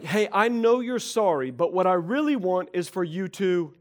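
a wife pushing past the apology; exasperated spouse. Retold or imitated. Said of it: "It's like".

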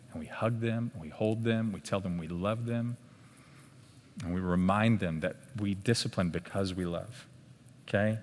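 usual speaking voice

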